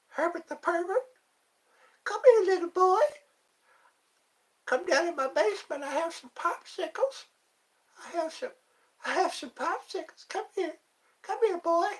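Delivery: very high, thin cartoon character voice; Herbert the Pervert. Coaxing someone to the basement, very high creepy impression. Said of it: "does a good Herbert the Pervert".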